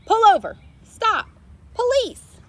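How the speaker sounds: very high, loud police shout; sheriff hollering. A narrator's reading. Sheriff hollering at the speeding truck. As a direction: bark the orders. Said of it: "Sheriff hollered out".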